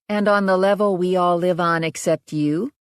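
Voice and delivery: lightly voiced exasperated young woman; Riley's voice, dry. A narrator's reading. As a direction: needle him drily.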